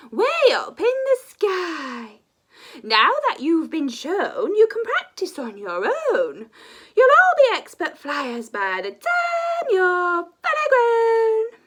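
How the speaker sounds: high, loud and slow schoolmistress voice; loud teacher dragon. Acted. Teacher dragon booming instructions to her young class. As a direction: proclaim it like a grand dragon teacher.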